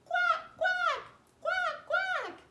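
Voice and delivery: very high, loud quack quack; duck quacks. Shown as a demonstration. Teacher leading duck pose, high loud quacks. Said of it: "We remember duck pose".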